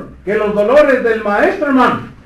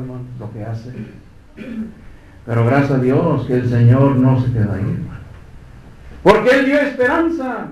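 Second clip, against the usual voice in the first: usual speaking voice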